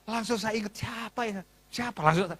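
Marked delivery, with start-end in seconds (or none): 0.8-2.0 much softer, wondering aside; puzzled self-questioning. Retold or imitated. Pastor trying to recall who called, quietly puzzled. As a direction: ask yourself under the shouting, very soft.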